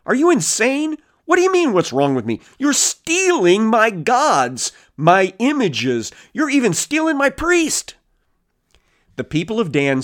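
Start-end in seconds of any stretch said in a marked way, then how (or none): 0.0-7.9 high-pitched mock outrage; incredulous squeals of indignation, much higher; ticked off. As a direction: imitate Micah sputtering at the thieves. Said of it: "like, ticked and going".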